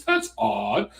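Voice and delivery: put-on British accent. Quoting the British feminist, lightly imitated British accent. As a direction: repeat "That's odd" in a light imitated British voice. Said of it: "in a British voice".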